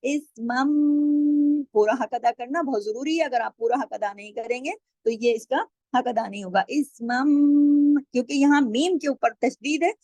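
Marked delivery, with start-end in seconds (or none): 0.0-1.6 इस मम very slow, मम held in the nose; long ghunna. Shown as a demonstration. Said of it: "आवाज को देर तक नाक में छुपा के". 6.7-8.0 इस मम very slow, loud, मम held long in the nose; full ghunna. Shown as a demonstration. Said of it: "सबसे बड़ा गुन्ना".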